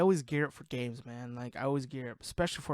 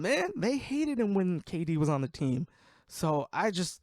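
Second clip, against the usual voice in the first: ordinary talk, nothing marked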